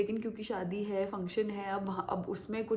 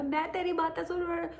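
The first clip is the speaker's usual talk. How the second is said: mock angry driver; 9 semitones higher than usual. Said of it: "कह रहा".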